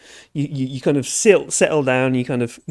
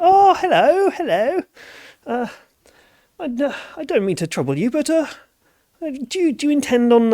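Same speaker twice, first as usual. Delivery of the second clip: high, fussy posh farmer voice; a well-to-do farmer, eager and polite; 11 semitones higher than usual. Acted. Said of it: "I don't know why this farmer's really posh".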